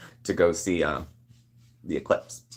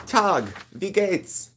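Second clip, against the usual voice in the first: German accent; 11 semitones higher, much slower than usual. Real, not acted. Greets German viewer in German.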